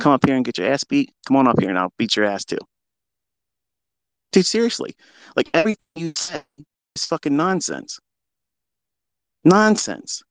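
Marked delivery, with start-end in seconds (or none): 4.3-10.2 high, incredulous and dismissive; exasperated. Real, not acted. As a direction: scoff at it in disbelief. Exasperated at rival.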